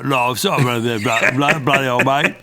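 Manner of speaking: hammed-up London Cockney; playful fake Cockney voice; Cockney accent. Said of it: "I'd like to go a little more Cockney with it".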